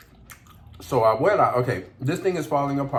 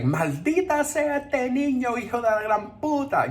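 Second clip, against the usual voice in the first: furious grandma; 12 semitones higher than usual. Retold or imitated. Imitating grandma cursing the boy after the sandal hits, high mock screech. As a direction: squawk it like an old lady losing it. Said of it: "she's like".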